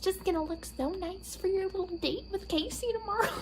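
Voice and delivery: high, doting mom voice; imitating mom. Imitating mom teasing about the date, high doting. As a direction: tease sweetly like a proud mother. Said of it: "She's like".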